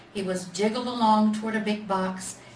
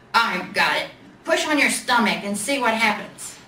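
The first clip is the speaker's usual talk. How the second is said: louder bear with a bright idea; big bear idea; 6 dB louder than usual. A narrator's reading. Big Bear tells the little bear to push his stomach, loud. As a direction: announce it like a eureka.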